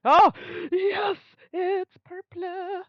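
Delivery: high, drawn-out squeal of relief; overjoyed relief. Real, not acted. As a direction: squeal it slowly, nearly tearful with joy. Drawn card turns out purple.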